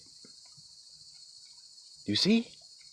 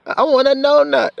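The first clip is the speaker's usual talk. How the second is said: high-pitched tearful child imitation; crying toddler; 11 semitones higher than usual. Son begging dad for another donut, high mock-crying whine. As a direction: plead like a little boy in tears. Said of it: "That's what he was calling it".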